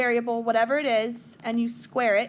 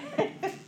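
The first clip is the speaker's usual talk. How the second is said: short laugh; 14 semitones higher, 4 dB quieter than usual. Real, not acted. Teacher laughs.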